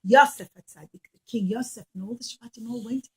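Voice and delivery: plain reading voice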